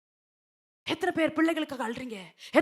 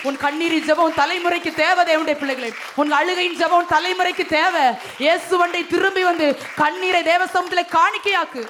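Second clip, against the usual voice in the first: loud, driving exhortation; full-force preaching; 3 semitones higher, 7 dB louder than usual. Acted. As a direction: thunder it at the congregation.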